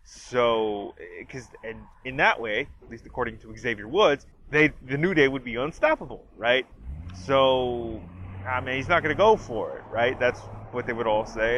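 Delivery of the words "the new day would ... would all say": normal talking voice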